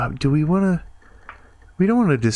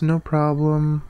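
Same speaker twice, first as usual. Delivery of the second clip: higher, drawn-out take; 5 semitones higher, slower than usual. Shown as a demonstration. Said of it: "I would always say no problem".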